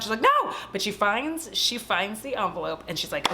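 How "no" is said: high, loud retold refusal; mock outburst. Heroine refusing Justin's calming words, mock high outburst. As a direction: burst out high over the soothing.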